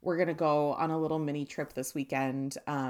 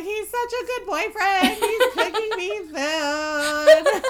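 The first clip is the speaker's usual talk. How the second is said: high, loud mock swooning; obsessed gushing; 14 semitones higher, 9 dB louder, slower than usual. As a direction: gush over him, stretching the last words way out. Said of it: "obsessed with him 'cause I was like".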